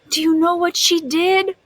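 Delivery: usual speaking voice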